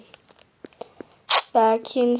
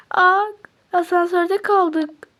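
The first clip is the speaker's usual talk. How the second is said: alarmed cry; 6 semitones higher, 5 dB louder, slower than usual. Acted. Asansörde kalma paniği.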